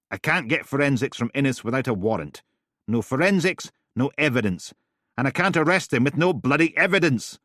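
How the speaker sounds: loud, high, insistent; heated argument. Acted. Steele arguing hard with Helen, heated and loud. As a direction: hammer the logic home angrily.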